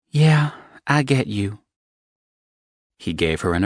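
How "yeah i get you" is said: higher voice, conceding; high woman's voice, resigned; resigned young woman. Acted. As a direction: give in with a sigh. Evangeline gives in.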